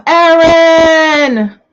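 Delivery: high, loud happy call of a name; light up as you call her in; thrilled shout-out to a returning client; excited greeting. Real, not acted. Greeting fans in live chat.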